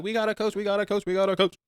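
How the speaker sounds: high-pitched, chanting imitation of kids; excited young girls. Excited girls arriving home, voiced.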